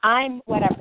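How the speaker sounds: ordinary talk, nothing marked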